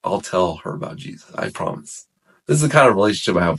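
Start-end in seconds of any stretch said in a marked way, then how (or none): 0.0-2.1 softly bargaining with God; quiet vow, trailing to near-whisper at the end; retold prayer. Busser promising God to witness to her, hushed prayer. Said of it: "And so I say".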